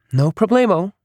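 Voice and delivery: breezy, high-pitched reply; casual. A narrator's reading. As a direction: toss it off casually, pitch lifted. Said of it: "Ronnie casually responded".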